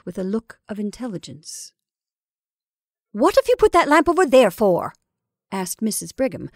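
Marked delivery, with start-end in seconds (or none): 3.1-4.9 loud, high, exasperated question; impatient. A narrator's reading. Mrs Brigham about the badly placed lamp, impatient. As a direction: demand it with open impatience. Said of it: "with more of impatience than her voice usually revealed".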